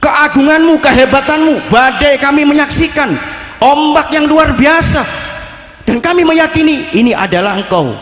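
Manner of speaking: high, fervent, slowed supplication; fervent prayer retold. The preacher retelling the saint's storm prayer, fervent and high. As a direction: pray with building awe.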